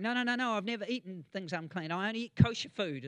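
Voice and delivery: lightly voiced reluctant Peter; protesting Peter. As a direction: push back like a squeamish disciple. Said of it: "Peter's going".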